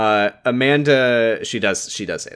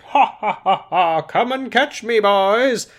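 high, taunting mock evil laugh; [name]; 10 semitones higher, slower than usual. [name] daring the boys to follow her, mock villainous. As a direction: cackle theatrically, then sing out a high-pitched dare. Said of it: "doing evil laughs".

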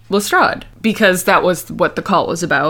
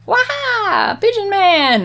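high gleeful exclamation, much higher; gleeful cry; 14 semitones higher, slower than usual. Retold or imitated. Friends spot the pigeon man, high gleeful cry. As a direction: shout it like spotting the culprit. Retold gleeful discovery shout.

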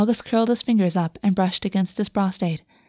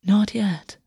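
soothing whisper. Acted. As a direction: hush him gently, breathy and slow. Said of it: "August soothed".

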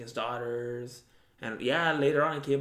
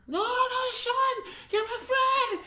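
very high, loud falsetto plea; hammed-up falsetto of a girl begging; mock pleading woman; 5 dB louder, much slower than usual. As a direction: squeal it like a scared girl in a hammy skit.

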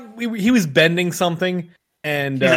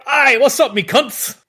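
high, hearty Australian greeting; mock Australian accent; 8 semitones higher, slower than usual. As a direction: hail the mates in put-on Australian. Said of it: "say it with an accent".

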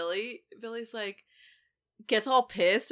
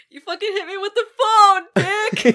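much higher, louder cry through laughter; laughing outraged yell; 13 semitones higher, 13 dB louder than usual. Acted. Podcaster doing Stu hit by the phone, laughing yell. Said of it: "what does Stu say?".

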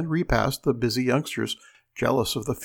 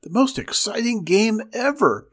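put-on high, breathless little girl's excitement; thrilled kid, pitched way up; excited gasp; 8 semitones higher, 3 dB louder than usual. A narrator's reading. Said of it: "gasped Mink".

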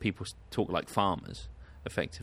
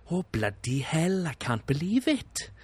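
higher, sing-song Welsh accent imitation; mock Welsh lilt, exasperated; 7 semitones higher than usual. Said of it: "Welsh people kind of sound like".

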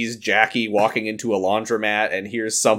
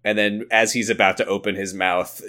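normal talking voice

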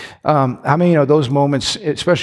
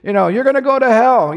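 much higher, mocking scold of a sinner; scolding preacher; 8 semitones higher than usual. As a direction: parody a finger-wagging pastor, pitched way up. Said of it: "our idea of holiness It's".

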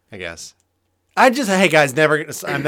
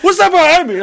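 high, loud panicky question; jumpy voice, suddenly alarmed; scared passenger; 14 semitones higher, 8 dB louder than usual. Pretend. Acting scared tandem passenger.

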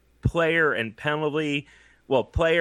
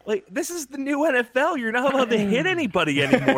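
incredulous; 9 semitones higher than usual. Real, not acted. Incredulous rant at fifty-five.

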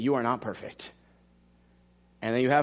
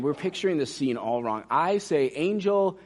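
ordinary delivery

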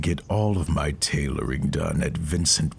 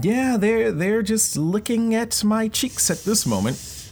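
high squeaky devil. Pretend. Devil speaking while burning.

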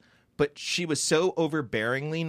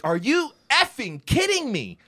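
high, slow, drawn-out incredulity; exasperated disbelief; 10 semitones higher, 4 dB louder, slower than usual. Retold or imitated. Brother reacting to sister's bad news, exasperated. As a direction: stretch out each word in disbelief.

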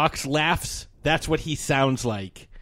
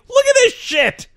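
much higher, louder incredulous call; retold excited yell to his partner; excited yelp; 6 dB louder than usual. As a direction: shriek it in disbelief. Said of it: "I'm like".

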